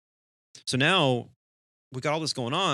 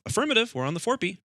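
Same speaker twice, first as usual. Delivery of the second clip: high, lilting mock confirmation; acted radio reply; 11 semitones higher, 3 dB louder, faster than usual. Pilot answering departure control, high chirpy confirmation.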